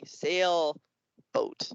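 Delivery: drawn-out word with a gap between halves; slow-talking turtle. Acted. Turtle puppet giving a compound word to kids, slowly split. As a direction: say "Sailboat" as two slow, separate parts. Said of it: "the turtle will say".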